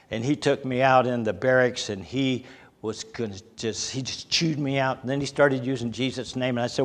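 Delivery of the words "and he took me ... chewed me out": ordinary delivery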